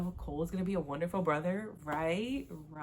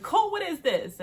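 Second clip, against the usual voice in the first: much higher and louder, accusing; loud confronting demand; 13 semitones higher, 8 dB louder than usual. Acted. Mother confronting her son in the garage, loud accusing demand. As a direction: demand an answer, voice way up.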